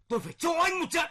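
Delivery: high, loud furious yell at the son; furious threat. Acted. Father yelling at his defiant son, furious. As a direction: roar out the threat to beat him.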